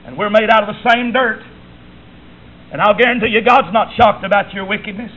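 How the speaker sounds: normal talking voice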